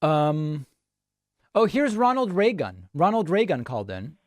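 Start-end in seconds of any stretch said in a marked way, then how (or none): none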